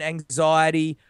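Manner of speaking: ordinary delivery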